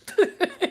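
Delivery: high burst of laughter; sudden hearty laugh; loud laugh. Real, not acted. Laughing at own joke.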